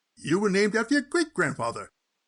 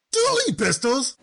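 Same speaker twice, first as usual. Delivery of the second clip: incredulous; 6 semitones higher than usual. Acted. Disbelief at a paltry inheritance.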